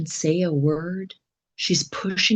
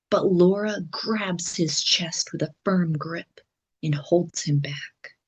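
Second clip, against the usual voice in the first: plain reading voice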